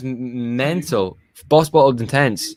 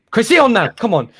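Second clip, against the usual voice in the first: loud, high shout at the screen; 11 semitones higher, 5 dB louder than usual. Real, not acted. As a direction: demand it of the manager like he can hear. Demanding a substitution at the TV.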